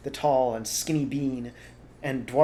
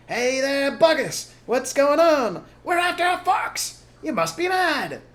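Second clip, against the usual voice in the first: high, loud jeering; mock the farmers from the rim; loud high mocking jeers; jeering crowd; 10 dB louder, slower than usual. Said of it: "The people jeered".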